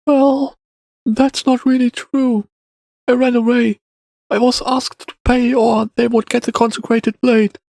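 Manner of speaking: put-on high-pitched boy owning up; child confessing. A narrator's reading. Boy admits he ran away, high child voice. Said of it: "It chewed silently on the morsel for a while before confessing".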